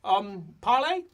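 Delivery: high, tentative offer; Felix. Acted. Felix offering parley to hostile dwarves, high and tentative. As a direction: pipe up with a hopeful question. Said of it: "Felix goes".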